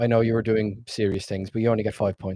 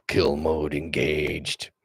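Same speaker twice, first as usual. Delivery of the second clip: slow, lower robot voice, word by word; a cold machine switching to kill mode, dragged out slowly; slow, low robot monotone; 6 semitones lower, much slower than usual. Shown as a demonstration. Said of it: "my standard robot voice".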